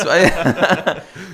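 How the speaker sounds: high, loud burst of laughter. Real, not acted. Laughing at his own joke to the co-host.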